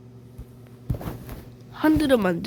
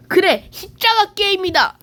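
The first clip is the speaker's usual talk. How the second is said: high, louder excited announcement; 6 semitones higher, 6 dB louder than usual. Real, not acted. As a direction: announce it with glee.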